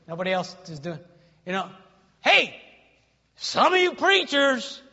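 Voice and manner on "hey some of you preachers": loud, high call to the room; raised preacher's challenge. Real, not acted. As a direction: shout for their attention, stretching the words. Calls out the preachers present.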